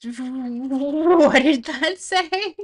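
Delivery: loud, high question through laughter; bursting out laughing. Real, not acted. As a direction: laugh helplessly while asking, dragged out. Laughing at garbled TTS voice.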